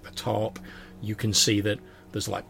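ordinary delivery

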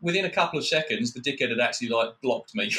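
ordinary talk, nothing marked

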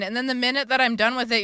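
usual speaking voice

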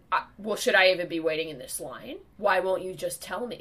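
loud, impatient imitation of a customer. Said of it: "his voice is getting louder and louder".

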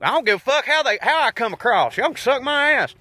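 put-on thick Southern accent. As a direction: push the Southern accent up high, cocky and defiant. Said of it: "most people that sound like that".

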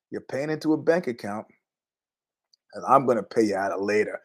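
low, soft menacing warning; said quietly. Retold or imitated. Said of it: "I said quietly".

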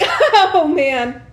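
loud, laughing "oh man"; mock attention-seeking groan; dramatic sigh. Imitating attention seeker sighing aloud.

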